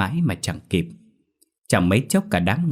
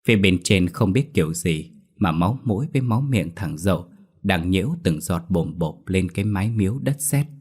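usual speaking voice

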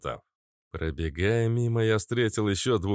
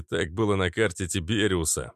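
plain reading voice

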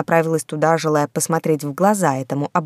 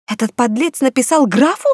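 hissing; 8 semitones higher, 4 dB louder than usual. Acted. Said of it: "прошипела Эдана".

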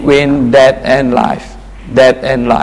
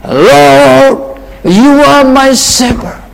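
raised, lifted voice addressing the Lord; prayerful psalm reading; 10 semitones higher, 3 dB louder, slower than usual. Acted. Preacher praying the psalm aloud to his congregation. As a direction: pray it upward to the Lord.

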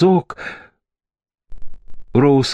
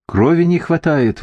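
a higher, urgent "не хватает"; alarmed exclamation; 7 semitones higher than usual. A narrator's reading. Marius warning over the dying girl, with urgent alarm. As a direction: blurt it out in alarm. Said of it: "воскликнул Мариус".

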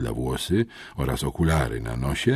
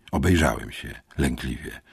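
ordinary delivery, nothing marked

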